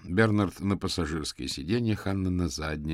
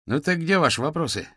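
higher, louder chipper question; brisk, cheery; 9 semitones higher, 5 dB louder than usual. A narrator's reading. Юрген asks his passengers while driving, cheerfully. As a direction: ask it perkily, unbothered. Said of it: "бодро поинтересовался Юрген".

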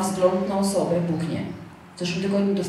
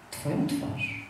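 very soft, breathy mock-solemn reveal; hushed mysterious reply; 11 dB quieter, much slower than usual. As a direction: whisper it like an ominous revelation. Retold reply about a vision.

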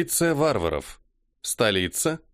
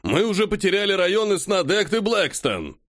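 heated arguing; 8 semitones higher than usual. Acted. Bearded man arguing in council.

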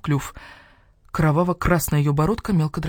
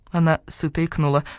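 plain reading voice

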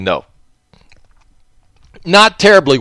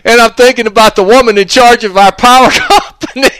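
real giggle breaking through, higher; laughing helplessly through the words; giggling at his own thought; 9 semitones higher, 3 dB louder than usual. Real, not acted. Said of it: "I ought not laugh".

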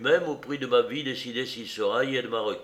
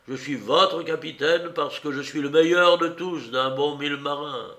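louder, higher mocking contempt; scornful taunt; 3 semitones higher, 6 dB louder than usual. A narrator's reading. Silver mocking the silent crew as their captain, scornful. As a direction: sneer at their cowardice.

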